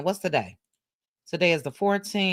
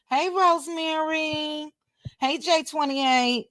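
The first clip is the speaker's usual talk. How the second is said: high greeting; 8 semitones higher than usual. Real, not acted. Greeting chat viewers by name.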